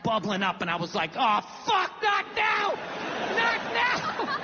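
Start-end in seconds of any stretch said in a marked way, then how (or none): 1.2-4.4 high, rising panicked cry; panicked protest. Retold or imitated. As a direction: fight back the feeling, voice climbing. Fighting down sudden emotion.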